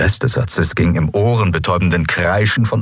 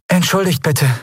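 warm, sweet voice; 12 semitones higher than usual. Acted. Young woman asks Endriel for help, sweet desperate voice. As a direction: plead sweetly and a little lost. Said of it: "mit einer Stimme, die ... so warm und lieblich".

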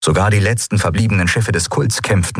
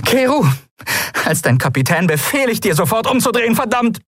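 high, sharp captain's command; commanding order. Acted. Endril as captain orders Keru to turn back, commanding. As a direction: order him back with a final curse.

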